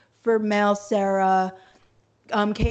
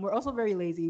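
ordinary delivery

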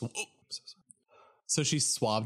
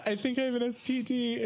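sheepish confession; 12 semitones higher than usual. Retold or imitated. Acting out embarrassed confession to receptionist.

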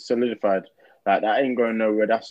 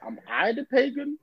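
self-doubting question; 12 semitones higher, 4 dB quieter than usual. Retold or imitated. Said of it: "look himself in the mirror and be like".

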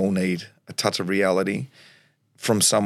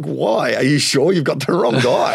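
disbelieving dad; 5 semitones higher, 4 dB louder than usual. Retold or imitated. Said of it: "he's like".

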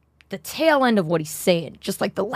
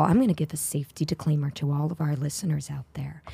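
mock disclaimer; 7 semitones lower, 7 dB quieter, faster than usual. Mock safety disclaimer to listeners.

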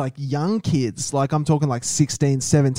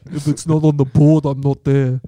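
impression of mate. Friend mocks co-host's strut, lightly mimicking him. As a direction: mimic your friend's swagger. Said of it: "That's my impression of you".

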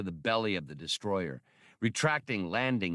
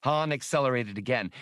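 plain reading voice

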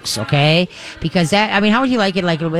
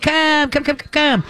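much higher, bright, singsong come; happy dog call; 10 semitones higher than usual. Shown as a demonstration. Host showing listeners how to call a dog cheerfully. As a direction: sound like fun to the dog. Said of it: "And so what you do is you squeak".

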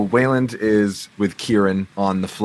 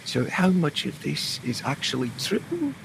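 lightly voiced doubtful aside; a sly, quieter question to a friend; a hint of a skeptical sailor; leaning in, curious; 8 semitones higher, 6 dB quieter than usual. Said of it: "just leans over and goes".

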